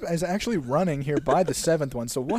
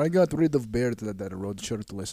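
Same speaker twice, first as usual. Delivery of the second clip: put-on deep-pitched Russian-accented captor; mock Russian accent; 5 semitones lower than usual. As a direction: lower comic Russian accent. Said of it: "now you have Russian accent".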